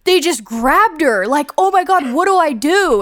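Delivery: hammed-up terror about a kidnapping; mock panic. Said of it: "just be like".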